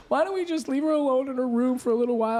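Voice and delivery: high imitation of niece's suggestion. Niece suggesting they leave grandmother alone, high voice. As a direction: lift the pitch into a niece's voice.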